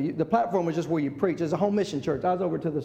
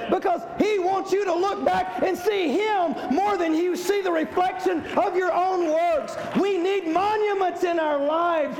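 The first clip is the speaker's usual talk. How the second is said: preaching at full force; 7 semitones higher than usual. Real, not acted. Pastor exhorting the congregation, full-force preaching. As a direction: build the sermon to a rousing peak.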